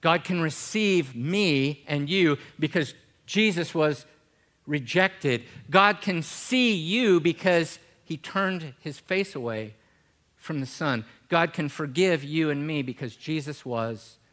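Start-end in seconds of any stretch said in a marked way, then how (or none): 0.0-11.0 loud, high, driving sermon; preaching at full force. Acted. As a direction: build the congregation up with fervour.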